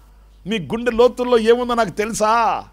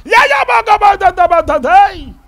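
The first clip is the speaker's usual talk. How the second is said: loud, high wordless bleating; mute straining sounds; 13 semitones higher, 11 dB louder, slower than usual. Retold or imitated. Struck-dumb priest trying to talk, loud high bleats. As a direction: try to speak but only strain out sounds.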